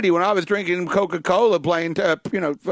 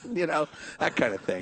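ordinary delivery

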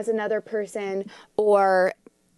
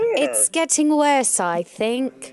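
slow, hammed-up British accent, self-mocking; 2 semitones higher, slower than usual. Co-host jokes about her own accent, mock British. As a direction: drag it out in a bad British accent. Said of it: "my British accent".

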